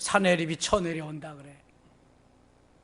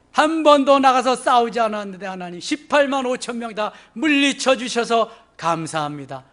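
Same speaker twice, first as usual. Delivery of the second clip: raised voice, easing toward the end; fervent thankful prayer; 6 semitones higher, 7 dB louder than usual. Acted. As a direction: pour out thanks as if praying. Acts out Hezekiah's thankful prayer. (목소리 높인 감사 기도)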